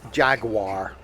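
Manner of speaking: Jaguar as two syllables, "JAG-wahr"; American mispronunciation. Retold or imitated. Mocking American cousins, Jaguar said as "jag-war". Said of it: "It's not Jaguar".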